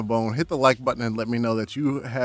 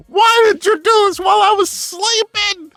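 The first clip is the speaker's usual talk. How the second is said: much higher, much louder angry shout; furious yell; 13 dB louder than usual. Retold or imitated. Boy yelling at doctors who set his arm, furious shout. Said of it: "I was so mad".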